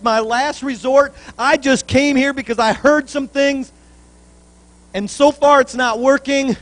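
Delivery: loud, high preaching build; fervent pulpit preaching; preaching at full force. Acted. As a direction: lift the congregation, loud and rising. Preacher voicing father's last chance.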